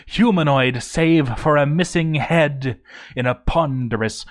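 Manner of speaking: plain reading voice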